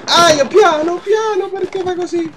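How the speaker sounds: sudden high, loud yelp of complaint; squealed protest, pitched far up; alarmed protest. Real, not acted. Player saying ouch, easy, alarmed protest.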